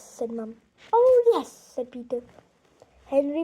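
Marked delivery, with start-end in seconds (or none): 0.9-1.5 put-on high, loud eager little boy; loud high sweet boy voice. A narrator's reading. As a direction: chirp it like a goody-goody child.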